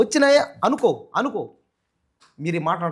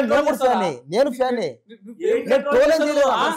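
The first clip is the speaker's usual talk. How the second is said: raised pitch, urgent self-defence; pleading protest; 4 semitones higher than usual. Real, not acted. Interviewee insisting to angry fans he is a fan, pleading. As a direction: protest your innocence in a higher voice.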